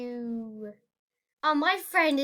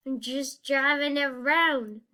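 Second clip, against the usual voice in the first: ordinary delivery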